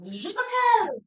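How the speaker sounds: squeaky high Je m'appelle; high mock French. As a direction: toss off Je m'appelle in a falsetto.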